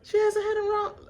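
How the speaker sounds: imitating Alan. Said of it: "he do like this".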